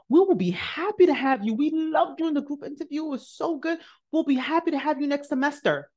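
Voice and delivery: high, bubbly professor voice; cheerful welcome. Retold or imitated. Professor welcoming the student next semester, high and cheerful.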